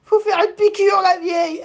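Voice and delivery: put-on high, whiny old woman's voice; imitating old lady. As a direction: play a confused granny. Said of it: "Et elle a fait".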